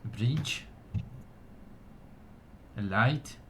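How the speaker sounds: measured ceremonial address to a gathering; solemn, projected speech of thanks; elf orator. Acted. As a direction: proclaim it to your people with pauses. Elf's ceremonial speech continues.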